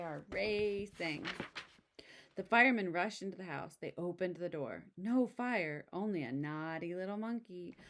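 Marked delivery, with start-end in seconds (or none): none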